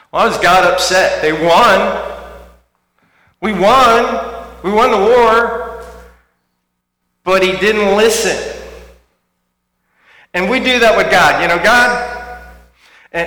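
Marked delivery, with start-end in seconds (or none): 0.1-10.9 loud, raised preaching; full-force preacher emphasis; fiery preaching. Acted. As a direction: drive the point home to the congregation.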